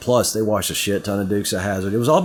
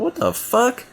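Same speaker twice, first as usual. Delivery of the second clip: much higher, incredulous outburst; shocked disbelief; slower than usual. Retold or imitated. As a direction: react as if you can't believe it. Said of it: "I'm like".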